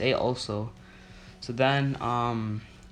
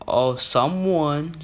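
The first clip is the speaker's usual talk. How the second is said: drawn-out, raised, loud mock complaint; imitated indignant teacher; 4 semitones higher, 6 dB louder, much slower than usual. Student mimicking teacher's accusation, drawn-out indignant voice. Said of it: "like this".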